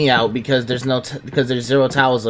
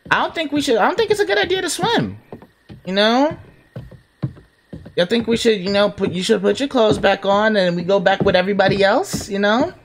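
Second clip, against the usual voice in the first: pleading, high voice; 7 semitones higher than usual. Pretend. Player pleading with game character Emma.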